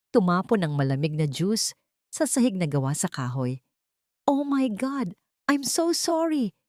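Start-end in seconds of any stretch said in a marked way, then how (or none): 4.3-6.6 high, slow, overdone mock sorry; fake apology. A narrator's reading. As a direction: gush the apology while secretly pleased. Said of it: "ang kanyang mga mata ay tuwang-tuwa".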